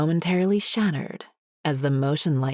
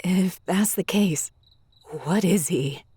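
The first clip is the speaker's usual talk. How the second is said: high, anxious wavering question; 4 semitones higher than usual. Acted. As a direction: ask in growing fear. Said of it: "my voice wavering with fear".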